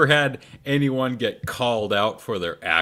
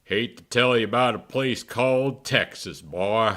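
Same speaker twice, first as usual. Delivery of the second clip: put-on Texas accent.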